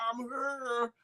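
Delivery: put-on a light New York accent on I'm here. Host imagining the viewer greeting him, light New York accent. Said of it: "with her New York accent".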